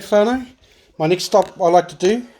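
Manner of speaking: normal talking voice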